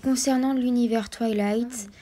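ordinary delivery

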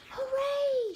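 very high, loud cheer; excited shout. A narrator's reading. George cheers at his find, high excited shout. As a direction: whoop it at the top of the voice. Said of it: "shouted George".

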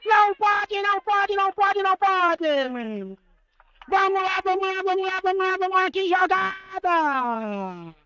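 long, drawn-out screaming goal call; explosive goal commentary. Real, not acted. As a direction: scream it out, stretching every word. Goal just scored, live narration.

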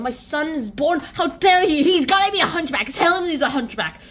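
loud, high-pitched indignant rant; mock outrage. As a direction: play the outraged father, loud and over the top. Acted outrage of the father.

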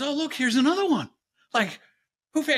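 high, fast, gleeful warlock voice; mocking warlock. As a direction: sneer at another victim. Warlock sees new girl.

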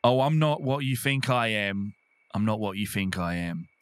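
usual speaking voice

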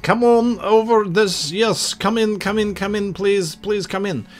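high, hammed-up Russian accent; bad Russian accent. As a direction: do your worst Russian accent, pleading over the radio. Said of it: "doing my worst Russian accent possible".

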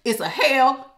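usual speaking voice

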